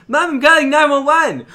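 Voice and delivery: put-on high, loud child shouting to mom; excited kid call. Little kid shouting to mom about the phone. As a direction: announce it proudly like a little kid.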